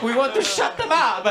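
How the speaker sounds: mock Italian accent on the English; imitating an Italian waiter's accent; a light Italian-accented waiter. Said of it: "the waiter goes".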